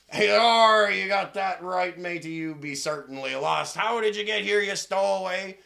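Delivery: hammed-up seafaring shout; yelling pirate captain. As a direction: bark at the stowaway like a pirate skipper. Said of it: "Please stop yelling at me".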